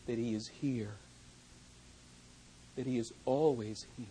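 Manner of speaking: low, soft, slow comfort; hushed reassurance. Acted. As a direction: lean in and reassure them quietly. Tender pastoral reassurance.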